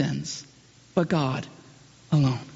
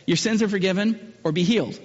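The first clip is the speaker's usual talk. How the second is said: ordinary delivery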